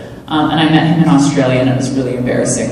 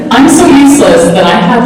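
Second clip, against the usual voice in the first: normal talking voice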